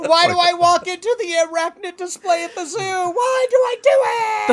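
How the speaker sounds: high wailing fake terror; hammed-up crying shout; mock panic.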